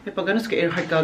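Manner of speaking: ordinary delivery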